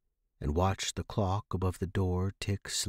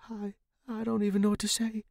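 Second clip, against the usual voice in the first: fast, higher, tripping over the first word; stammering; 11 semitones higher, faster than usual. Acted. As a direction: stumble out the words in shock. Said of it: "I stammered".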